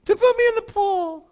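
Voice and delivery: high, slow, far whiny complaint; mock the sick man whining about the pool; whiner. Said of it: "that's what whiners sound like".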